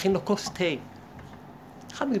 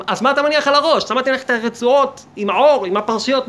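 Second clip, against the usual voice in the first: raised, louder mock incredulity; incredulous challenge; 6 semitones higher, 7 dB louder than usual. Speaker challenges one doubting the king's crown, acted incredulity. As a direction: fire the question as an exasperated challenge.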